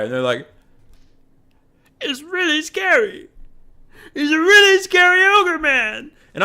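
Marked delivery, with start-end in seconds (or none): 2.0-6.1 much higher, slow, mock-frightened; high, slow, whimpering mock coward; whiny coward voice. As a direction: play the allies as scared children.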